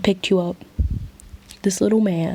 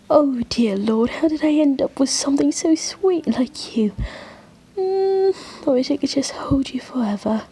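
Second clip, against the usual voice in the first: higher, doting and grateful; gushing affection; 4 semitones higher than usual. Acted. Angel hugging a beloved friend after a gift, gushing. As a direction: coo adoringly through a tight hug.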